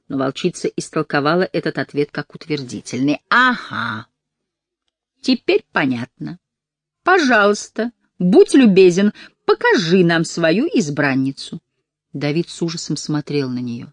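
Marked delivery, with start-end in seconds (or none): 3.3-11.6 fake friendly. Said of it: "Её дружелюбный тон не предвещал добра".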